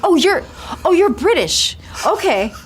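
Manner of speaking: high, loud startled realization, played for laughs; mock surprise. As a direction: act caught off guard by the accent. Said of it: "I was like".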